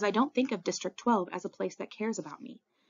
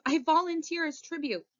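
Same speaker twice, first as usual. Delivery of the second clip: high, urgent plea to be taken; gasped declaration; 10 semitones higher than usual. A narrator's reading. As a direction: gasp it out, pitched high. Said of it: "I gasp".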